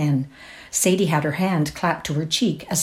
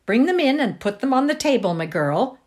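ordering; 9 semitones higher than usual. A narrator's reading. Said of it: "ordered cook".

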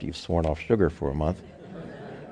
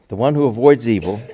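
ordinary talk, nothing marked

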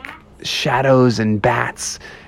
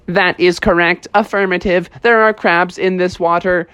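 higher, even robot monotone; robot bear; 5 semitones higher than usual. Acted. Techno Bear confirming the crabs, even robotic voice. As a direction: report it word by word like a machine.

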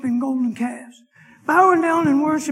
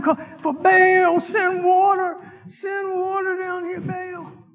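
higher, slow pleading cries to Baal; pleading Baal worshippers; 7 semitones higher, slower than usual. Retold or imitated. Preacher imitating prophets begging Baal for water, pleading. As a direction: beg the idol for rain, fading out. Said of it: "they've been crying and crying".

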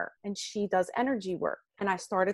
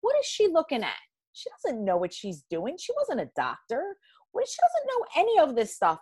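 exasperated; 8 semitones higher than usual. Retold or imitated. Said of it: "And I say to my husband".

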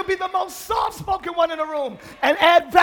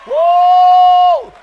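loud woo shout; 13 dB louder than usual. Real, not acted. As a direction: whoop to lift the room. Preacher hype shout.

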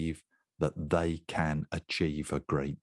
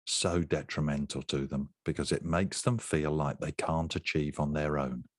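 usual speaking voice

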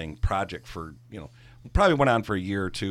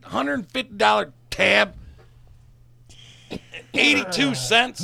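much higher, louder and slow; outraged disbelief; 13 semitones higher, 5 dB louder, slower than usual. Real, not acted. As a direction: spell out the insult word by word. Outraged recap of the tip.

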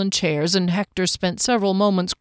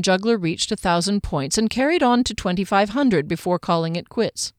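usual speaking voice

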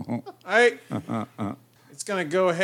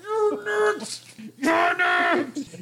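through gritted teeth; 13 semitones higher, much slower than usual. Retold or imitated. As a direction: spit it out through clenched teeth. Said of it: "She says through gritted teeth".

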